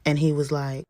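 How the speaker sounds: ordinary delivery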